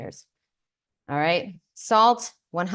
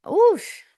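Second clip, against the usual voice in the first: oof; 12 semitones higher, 4 dB louder than usual. Real, not acted. Reaction after eating soursop.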